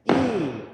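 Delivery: a loud accented "E"; accented note name. Shown as a demonstration. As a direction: hit the "E" hard on the beat. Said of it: "Let me hear that accent".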